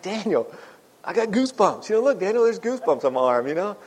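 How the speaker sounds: grab your son's arm and gush over the goosebumps; a dad at Rupp Arena showing his son his goosebumps, thrilled; excited. Retold or imitated.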